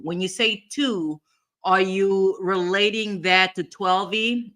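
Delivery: ordinary delivery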